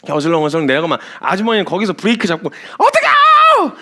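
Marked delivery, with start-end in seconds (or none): 2.8-3.7 much higher, louder mock shriek; panicked shriek. Woman braking on the ramp shrieking in mock panic. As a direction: squeal it like a terrified driver. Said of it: "이러고 있어요".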